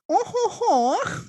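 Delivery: high, drawn-out mock-French wail; hammed-up sickly king voice. Shown as a demonstration. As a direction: groan it high like an ailing king. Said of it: "my sickly king voice".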